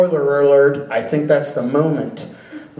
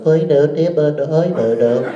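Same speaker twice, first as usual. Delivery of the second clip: robot babble; 2 semitones lower than usual. Retold or imitated. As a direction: imitate a droid burbling nonsense. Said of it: "that weird robot goes".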